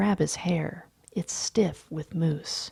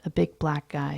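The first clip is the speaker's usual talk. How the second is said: plain reading voice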